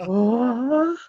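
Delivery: high, loud, breathy swooning "oh"; mock ecstatic swoon of the makeup artist; starstruck "oh". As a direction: gasp it like he's overwhelmed by a hero. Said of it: "made this sound I've never heard come out".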